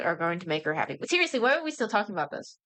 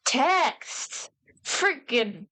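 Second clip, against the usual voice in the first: high exasperated yelp of "Text!"; fed-up shout at the comic; exasperated cry; 4 semitones higher, much slower than usual. Real, not acted. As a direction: throw up your hands at the text. Ranting at comic's hard-to-read text.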